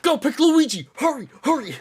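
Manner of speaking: high, loud urgent holler; re-enacted frantic shout; retold yelling. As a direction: holler excitedly, rushing someone along. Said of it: "I'm not gonna yell".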